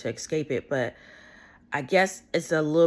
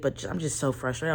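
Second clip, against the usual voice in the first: normal talking voice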